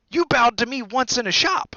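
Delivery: put-on high, loud boyish voice; surprised recognition. A narrator's reading. Harry remembering Diggle in a shop, high and excited. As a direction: pipe up as memory clicks.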